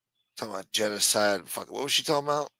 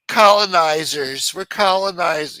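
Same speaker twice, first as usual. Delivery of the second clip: higher, louder, slow sneering parody; mocking slogan; 7 semitones higher, 9 dB louder, slower than usual. Ranter sneering at protesters' slogans, loud slow mockery. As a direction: drag it out like a mocking chant.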